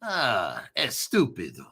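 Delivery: a hint of a Spanish accent on estúpido; mock Spanish. Teasing himself in a lightly Spanish-accented insult. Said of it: "and that's Spanish".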